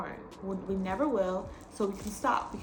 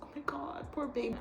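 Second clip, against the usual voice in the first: higher, softer cooing over a poor thing; tender pity; 5 semitones higher, 8 dB quieter than usual. Retold or imitated. Watching Dopey get laughed at, soft cooing pity. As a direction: coo it like over a hurt puppy.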